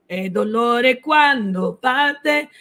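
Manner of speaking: "E dolore quando parte" drawn out slowly in Italian; slow Italian line. Shown as a demonstration. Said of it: "Cuando means when".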